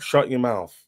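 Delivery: ordinary talk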